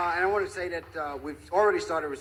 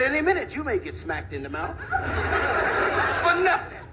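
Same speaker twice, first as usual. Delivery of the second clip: high-pitched blustering little tough guy; mock threat; 8 semitones higher than usual. Little fellow threatening to smack the big guy, blustering. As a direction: punch out the threat in bursts, much higher.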